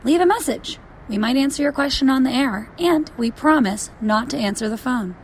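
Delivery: animated, emphatic announcer delivery; lively scripted hotline plug; podcast promo read. Acted. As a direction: pitch the hotline to listeners with punch.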